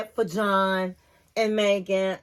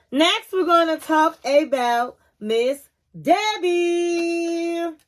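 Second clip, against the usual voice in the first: slow, higher announcing of the next topic; slow drawn-out announcement; 4 semitones higher, slower than usual. Shown as a demonstration. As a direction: stretch out every word like a show host. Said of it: "Okay, we're gonna talk about Miss Debbie".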